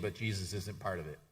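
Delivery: ordinary talk, nothing marked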